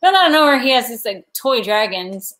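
ordinary talk, nothing marked

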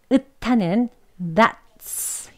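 읒하는 that's very slow, stop then s; slow linked that's. Shown as a demonstration. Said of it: "이렇게 연결하여서 발음". (아주 천천히 읒하는 that's)

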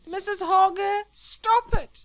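high, loud desperate cry through the wall; frightened cry. Acted. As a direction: beg them to stop at the top of his voice. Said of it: "he cried".

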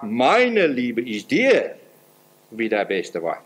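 slow, tender verse read; slow devout quote. Acted. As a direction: draw the words out like an offering. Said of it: "hat sie gesagt".

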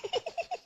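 short high giggle; a quick amused giggle; light laugh. Real, not acted. Laughing at his own joke about corona, short giggle.

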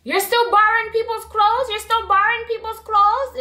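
put-on high-pitched, catty Toronto girl; mock her in a snide, high Toronto accent. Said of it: "In her little Toronto catty accent".

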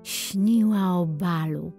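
ordinary delivery

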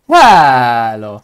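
loud, drawn-out call; loud hello. Real, not acted. Streamer calling out to a downcast friend, loud hello. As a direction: belt out one long greeting.

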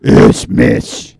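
very loud, low harsh German-accent impression; loud mock German. German impression blown up into the mic, loud. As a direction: blast it out in a hammed-up German accent. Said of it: "every time you get louder".

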